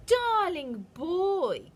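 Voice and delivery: high, slow, syrupy sweet witch; cooing witch. A narrator's reading. Witch sweetly luring the boy, high sugary coo. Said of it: "cooed the Grand High Witch".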